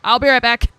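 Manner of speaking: loud, cheerful call-out. Real, not acted. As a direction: call it out to the room. Loud sign-off before leaving.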